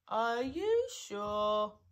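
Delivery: very slow, doleful question; gloomy. A narrator's reading. Eeyore doubting his tail is gone, gloomily. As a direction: stretch each word out glumly.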